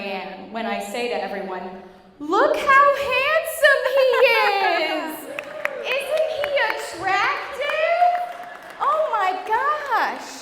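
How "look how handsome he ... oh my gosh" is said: high, loud, slow gushing mother; Mary Ann impression. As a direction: gush like a doting proud mom. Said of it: "here's where I get to do my favorite impression, which is of Tom's mother".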